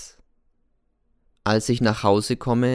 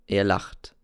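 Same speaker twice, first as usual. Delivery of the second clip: ordinary delivery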